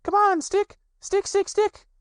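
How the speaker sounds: very high, loud cheering on; Roo calling out. A narrator's reading. Little Roo calls to his stick from the bridge, high and loud. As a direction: urge the stick along like an impatient child. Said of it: "calling out".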